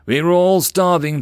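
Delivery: slow, high, loud plea; pleading merchant. Acted.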